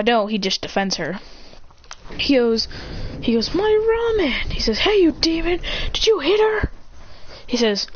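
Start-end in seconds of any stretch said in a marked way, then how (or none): 3.5-4.5 high, drawn-out cry; dismayed wail. Acted. Demon losing his ramen bowl, wailing. 4.8-6.7 high-pitched challenge; angry accusation. Acted. Kiba defending Sakura from the demon, accusing.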